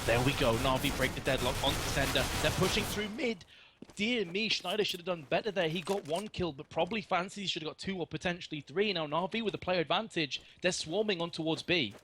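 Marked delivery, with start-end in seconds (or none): 2.4-11.9 higher excited play-by-play; esports caster. Acted. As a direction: call the push with rising energy. Live play-by-play.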